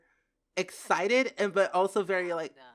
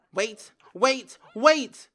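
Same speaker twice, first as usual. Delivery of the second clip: rising, louder panicked pleas; alarmed; 4 semitones higher, 9 dB louder, much slower than usual. Real, not acted. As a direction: urgently try to stop it, building louder. Alarmed at game events.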